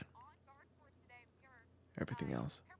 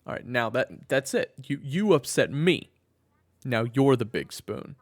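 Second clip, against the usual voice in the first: loud, higher, slow playful indignation; mock outrage; 7 semitones higher, 14 dB louder than usual. Husband pretending to be upset at his wife's joke, loud and slow. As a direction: ham up being offended.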